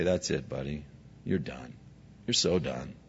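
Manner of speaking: hushed, dismissive brush-off in a low voice; mock the dismissal quietly, an octave down; quiet dismissive God.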